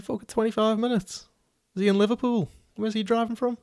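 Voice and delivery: a high-pitched, exasperated "are you kidding me"; outraged at the wait, voice shooting up; incredulous inner thought. Retold or imitated. Inner exasperated thought about waiting.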